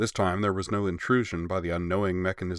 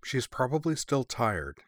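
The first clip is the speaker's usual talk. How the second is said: higher, gently reasoning voice; reasonable; 6 semitones higher than usual. A narrator's reading. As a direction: offer it as a sensible explanation. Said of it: "said Azusa reasonably".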